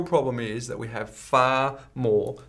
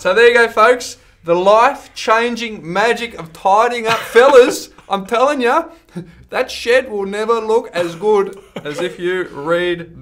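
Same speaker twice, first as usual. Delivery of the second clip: higher, louder hammed-up sales pitch to the fellas; 8 semitones higher, 9 dB louder, slower than usual. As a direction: sell it like a cheesy infomercial. Hamming up a plug to listeners.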